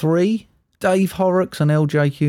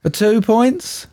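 usual speaking voice

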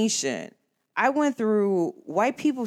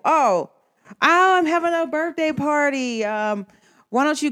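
mock friendly invite; 7 semitones higher, 4 dB louder than usual. Imitating friend's party invite.

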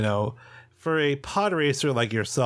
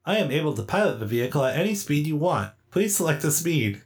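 higher, quick robot voice; politely literal droid, pitched up; literal servant droid; 4 semitones higher, faster than usual. Acted. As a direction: recite it like an obliging robot. Game master plays literal pilot droid.

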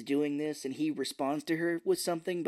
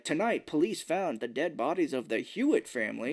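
sing-song newsreader cadence; TV news anchor. Acted. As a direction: read it like a TV news bulletin.

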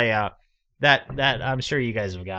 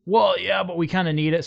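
higher, sheepish admission; sheepish high scammer; 9 semitones higher, 5 dB louder than usual. Retold or imitated. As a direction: confess it, caught out. Scammer voiced in story.

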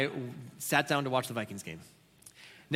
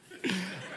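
quick amused chuckle; short laugh; 4 semitones higher, 5 dB quieter than usual. Real, not acted. Pastor laughing at his own football story. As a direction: laugh off the frustration.

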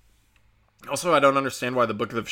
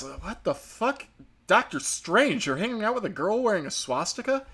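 mock shocked; 7 semitones higher than usual. Stranger shocked at the pin.

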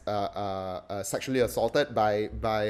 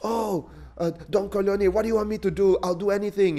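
higher, eager pleading; protesting plea; 9 semitones higher than usual. Retold or imitated. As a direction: beg the Don to name his price. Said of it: "Bonazera protests".

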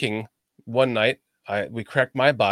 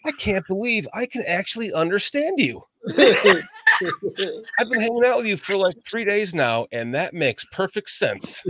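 higher-pitched, laughing astonishment; amused disbelief; 6 semitones higher than usual. Retold or imitated. Telling a friend he finally understands him, laughing disbelief. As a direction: retell it incredulously, breaking into laughter.

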